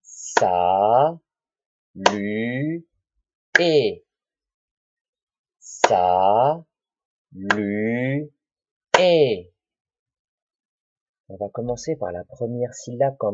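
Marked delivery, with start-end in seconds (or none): 0.0-4.0 "Sa, lu, et" stretched, one syllable at a time, long gaps between; cut into syllables. Shown as a demonstration. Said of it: "il faut qu'on coupe en syllabes". 5.6-9.5 "Sa, lu, et" stretched, one syllable at a time, long gaps between; cut into syllables. Shown as a demonstration. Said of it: "il faut qu'on coupe en syllabes".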